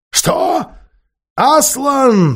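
very high, loud witch's cry; witch's piercing, startled shriek; shrieked outcry. A narrator's reading. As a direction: cry out in shock, voice leaping up. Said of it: "вскричала колдунья".

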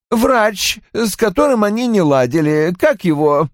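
high-pitched, complaining character voice; peevish man recalling a ruined day; querulous husband. A narrator's reading. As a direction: pitch it up and grumble about the disaster. Narrator voices Roy complaining.

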